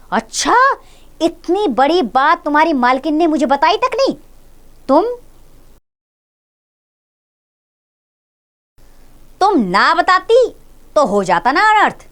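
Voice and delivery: high, loud feigned shock; mock astonishment. A narrator's reading. Master feigning shock at the maid, hammed-up astonishment. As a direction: play it as if the sky just fell. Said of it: "जैसे आसमान से गिरा हो".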